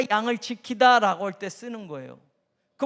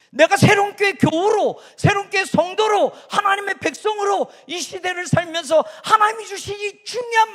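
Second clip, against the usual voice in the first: high, loud preaching cry; rally the congregation; preaching at full force; 7 semitones higher, 7 dB louder than usual. Acted. Preaching climax. (힘차게 외치는 설교)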